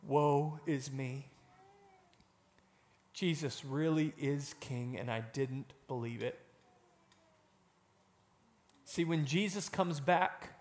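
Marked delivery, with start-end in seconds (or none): 0.0-6.4 mournful woe. Acted. Unbelievers at Jesus's return, softly lamenting. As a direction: sigh it out in regret. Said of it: "they're gonna say".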